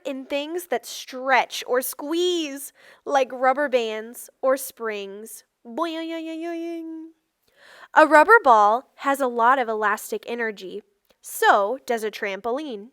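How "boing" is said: a playful higher boing, like a bouncing spring; springy boing. Acted. Reading to children, a playful higher spring boing. Springy sound effect in text.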